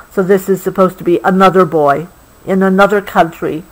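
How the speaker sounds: ordinary delivery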